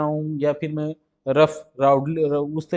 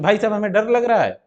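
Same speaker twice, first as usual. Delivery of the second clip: 1 demo of fear; 5 semitones higher, 6 dB louder than usual. Shown as a demonstration. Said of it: "अगर डर में हैं हम".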